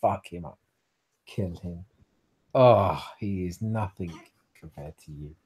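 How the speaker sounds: low, dark beast voice; low goading voice that sinks away; inner beast. Acted. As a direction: urge the kill in a low voice. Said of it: "your beast is flaring up and going".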